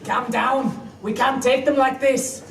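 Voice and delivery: lightly voiced Scots warrior, lowish; a Braveheart Scots burr, half laughing; mock Scottish accent. Scots ally frets about counting in a Scots accent.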